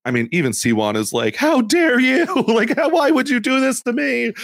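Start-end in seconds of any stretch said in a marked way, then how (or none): 1.3-4.3 high-pitched wail of betrayal; hammed-up, affronted outcry; mock indignation. Podcaster imitating a betrayed player confronting an ally, mock indignant.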